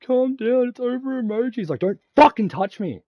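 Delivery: very high, very loud shrill mimicry then yelled retort; mocking high voice. Girl telling him to calm down, mimicked very high and loud. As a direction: squeal it, then yell back. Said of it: "the chick who's trying to touch me telling me".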